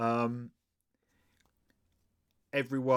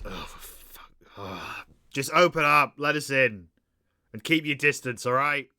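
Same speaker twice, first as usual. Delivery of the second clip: muttered sigh rising into an impatient call; fed up, breaking into a raised voice; exasperated; 3 semitones higher, 4 dB louder than usual. Acted. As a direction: sigh under your breath, then demand entry loudly. Acts Hancock exasperated with the guard.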